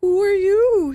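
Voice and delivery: a little frightened. Acted. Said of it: "a little frightened".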